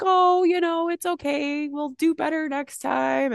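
high cheery consoling; pep-talk a sulking partner brightly; high sweet "it's okay" voice; upbeat reassuring self. Retold or imitated. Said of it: "I'm always like".

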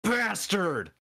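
high, hammed-up curse; mock derogatory insult. Shown as a demonstration. Co-host translating a naughty word, mock outraged insult. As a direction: spit it out with playful venom. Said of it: "just this very derogatory".

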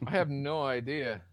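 ordinary talk, nothing marked

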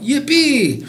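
high-pitched storybook whoop; lightly acted cheer; excited cry. A narrator's reading. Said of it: "cried out".

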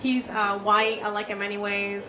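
loud, punchy stand-up set; stand-up delivery. Acted. On stage joking to the crowd about her husband, loud and punchy. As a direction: work the crowd with big energy.

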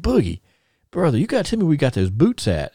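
eager ask. Retold or imitated. Teller to Jimmy Valiant, asking about the boots, eagerly. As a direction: pitch it up, keen to know where he got them.

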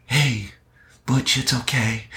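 high, gentle coo to comfort a dog; high-pitched tender reassurance; soothing soft talk. Shown as a demonstration. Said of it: "we talk softly".

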